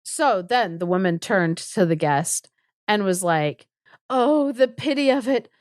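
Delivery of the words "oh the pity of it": higher-pitched theatrical pity; hammed-up woeful pity; mock lament. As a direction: lament with exaggerated sympathy. Guest's wife bemoaning his fate, acted.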